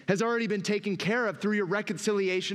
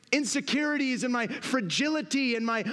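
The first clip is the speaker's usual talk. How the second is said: ordinary talk, nothing marked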